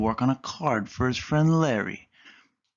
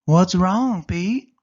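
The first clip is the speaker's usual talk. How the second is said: higher, louder worried question; worried Callie; 7 semitones higher, 7 dB louder, much slower than usual. Acted. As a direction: ask in alarm. Callie finds friend panicking.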